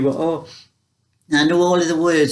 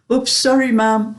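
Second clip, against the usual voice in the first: higher, lightly acted oops-sorry; mock apology; 7 semitones higher than usual. Daughter apologising to her mother after a slap, mock sheepish. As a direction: play the caught-out daughter, pitched up.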